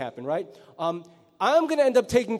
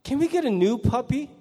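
put-on whiny kid; 5 semitones higher, 4 dB louder than usual. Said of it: "What will the kid say".

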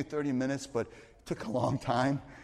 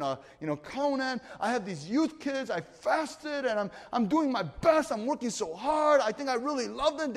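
fast passionate rant; 8 semitones higher than usual. Retold or imitated. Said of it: "I used to speak even faster and louder".

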